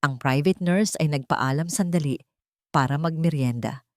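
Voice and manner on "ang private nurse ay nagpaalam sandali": usual speaking voice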